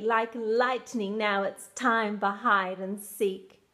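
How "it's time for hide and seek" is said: usual speaking voice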